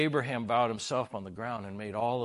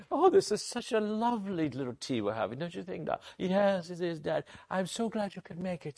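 lightly grand tea talk; 7 semitones higher than usual. Pretend. Pretend tea party dialogue.

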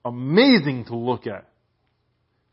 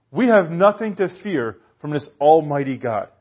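ordinary talk

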